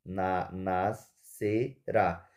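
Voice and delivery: "nascerá" split very slowly into na, sce, rá; teacher's slow syllable division of "nascerá". Shown as a demonstration. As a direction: spell out "nascerá" in separate syllables. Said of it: "silábica é nascera".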